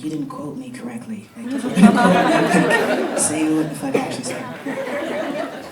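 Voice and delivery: half-laughing retold outburst; mock angry cousin. Retelling the cousin's complaint about the poem, laughing mock anger. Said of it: "he was mad 'cause he was like".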